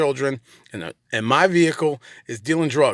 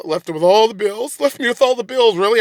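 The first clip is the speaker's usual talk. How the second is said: louder, higher, incredulous echo; mock indignation; 6 semitones higher, 5 dB louder than usual. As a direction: throw the accusation back in disbelief. Indignantly echoing ex's accusation.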